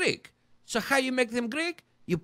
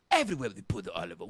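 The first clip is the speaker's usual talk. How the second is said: fast, low rattled-off catchphrase; rushed mantra; much faster than usual. Acted. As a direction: fire it off like a slogan. Repeating the olive oil mantra.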